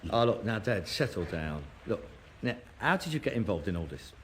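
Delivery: low, very soft, placating; calming down. Acted. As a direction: humour him gently, voice right down. Calming father down.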